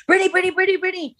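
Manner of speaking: high mock calls of a photographer crowd; paparazzi calling. Friend mimicking paparazzi shouting for [name], high calls.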